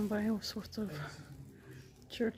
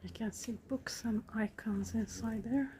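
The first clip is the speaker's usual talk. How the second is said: hushed church whisper; 5 dB quieter than usual. Real, not acted. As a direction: keep it to a soft whisper. Whispering inside a quiet church.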